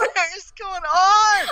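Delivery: scared horror game streamer. Acted.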